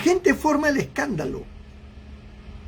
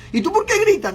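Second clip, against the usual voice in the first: high, sharp questioning; puzzled question; 9 semitones higher, faster than usual. Retold or imitated. A bystander asking a yelling man why, high and puzzled. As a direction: ask it high and incredulous.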